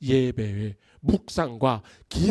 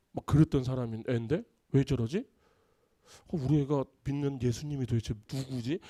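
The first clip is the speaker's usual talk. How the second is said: softly musing to oneself; quiet puzzled thinking aloud; wondering aloud; 5 semitones lower, 8 dB quieter than usual. Retold or imitated. A husband wondering about his changed wife, soft and puzzled.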